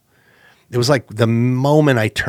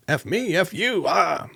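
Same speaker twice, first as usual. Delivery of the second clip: mock quarrel; 10 semitones higher, slower than usual. Pal firing back during volleyball, mock indignant. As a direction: imitate an affronted comeback up high.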